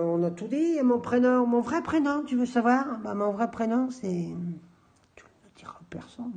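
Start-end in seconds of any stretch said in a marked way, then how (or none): 5.2-6.3 hushed, secretive whisper; confidential whisper. Pretend. About to share his real first name, whispering softly. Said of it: "Je le dis tout doucement".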